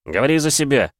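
ordinary delivery, nothing marked

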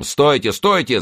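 high, urgent call to halt; commanding cry. A narrator's reading. Leader halting his attacking horsemen, commanding cry. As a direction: cry out to stop the riders. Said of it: "закричал тот".

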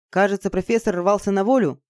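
plain reading voice

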